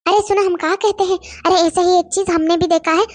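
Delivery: ordinary delivery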